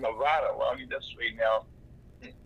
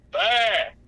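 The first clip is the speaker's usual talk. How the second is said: a loud, clipped one-word answer; loud short reply; 7 semitones higher, 9 dB louder than usual. Real, not acted. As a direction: bark it back, short and loud. Host asks Red, terse reply.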